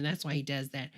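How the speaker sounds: ordinary talk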